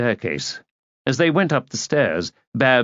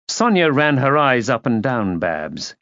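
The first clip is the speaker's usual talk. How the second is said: usual speaking voice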